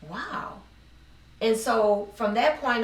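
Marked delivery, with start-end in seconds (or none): 0.1-0.6 soft, high-pitched wow of wonder; quietly marvel, recalling the moment; retold amazement. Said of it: "And I was like".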